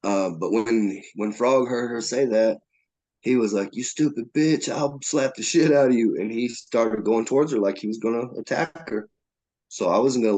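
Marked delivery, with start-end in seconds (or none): 3.7-6.2 raised-pitch mock outburst; imitated angry threat. Frog threatening Emily after her remark, imitated angry threat. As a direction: lash out as the retold attacker.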